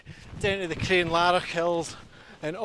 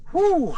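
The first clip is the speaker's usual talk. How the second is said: loud high exhale of relief; exhausted whew; 13 semitones higher, 6 dB louder than usual. Real, not acted. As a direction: blow out a tired whew. Exhausted on a steep climb.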